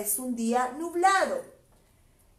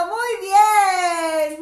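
loud, high praise; cheering; 4 semitones higher, 9 dB louder than usual. Real, not acted. Teacher cheers a child for counting. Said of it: "Aarón está contando".

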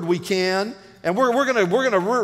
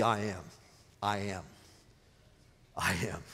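"I am" said softer each time, leaning on "am"; stress on I am; 13 semitones lower, 13 dB quieter than usual. Shown as a demonstration. Preacher stresses "I am" softly to his church. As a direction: let "I am" sink in, quieter each time. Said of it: "don't miss the".

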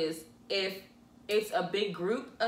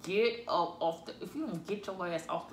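low hissed scolding through teeth; mother talking through her teeth; through gritted teeth; 3 semitones lower, 5 dB quieter than usual. Retold or imitated. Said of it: "talks through her teeth to you".

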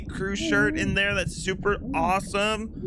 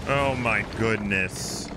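slow, drawn-out, lower but at normal level; slow dismay; 13 semitones lower, slower than usual. Real, not acted. Repeating it to chat, slow drawn-out disbelief. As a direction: stretch each word in disbelief.

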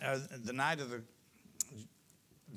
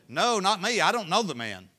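higher, louder quick brush-off; hasty denial; 6 semitones higher, 10 dB louder than usual. Acted. Peter denying Jesus to the crowd, higher and louder. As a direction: wave it away fast.

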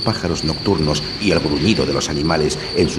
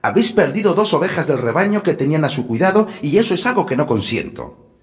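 wicked stepmother=6; 9 semitones higher than usual. A narrator's reading. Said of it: "le dijo un día".

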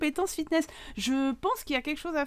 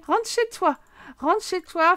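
high, raised-voice send-off; urgent ordering; 6 semitones higher, 5 dB louder, slower than usual. Pretend. Player telling a game character to go home, urgent ordering. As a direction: shoo them home, high and loud.

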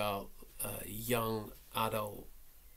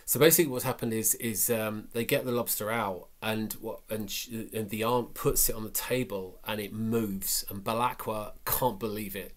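ordinary talk

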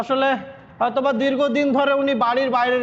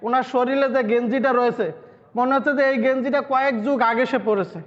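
ordinary talk

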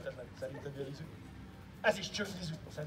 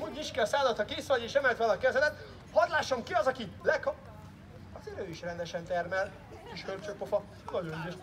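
ordinary talk, nothing marked

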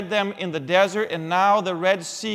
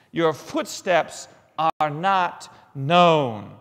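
slow and solemn; 2 semitones lower, much slower than usual. Acted. Solemn slow scripture line.

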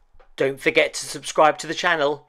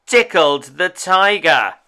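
ordinary delivery

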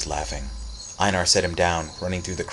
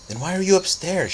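put-on boy asking; 11 semitones higher, 3 dB louder than usual. Boy voiced questioning Cece.

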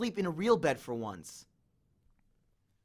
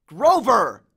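much louder shout; 5 semitones higher, 11 dB louder than usual. A narrator's reading. Percy shouting at Grover lost in the game. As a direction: yell his name. Calling him again.

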